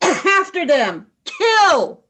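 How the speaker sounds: coughing then a loud, high order to kill; furious command. Acted. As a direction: spit out the kill order in rage. Enraged leader orders pursuit.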